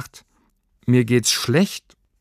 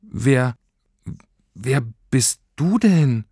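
halting, higher, with a long pause; stammering; 6 semitones higher, slower than usual. A narrator's reading. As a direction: stammer the first word, then slowly finish. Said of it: "stammelte sie". (stockend gestammelt)

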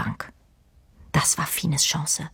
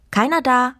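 sudden call; 7 semitones higher than usual. A narrator's reading. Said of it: "rief plötzlich jemand".

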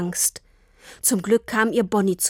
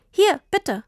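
put-on high, happy child handing it over; beaming; 10 semitones higher, 3 dB louder than usual. A narrator's reading. Tini hands Papa the racing bike, beaming. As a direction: offer it brightly, pitched up. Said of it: "sagte Tini strahlend".

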